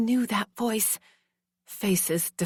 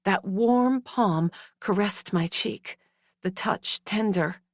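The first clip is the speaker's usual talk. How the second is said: ordinary delivery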